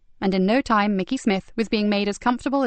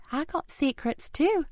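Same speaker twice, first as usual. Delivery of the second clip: put-on sleepy boy; 8 semitones higher, 5 dB quieter, slower than usual. Drowsy boy.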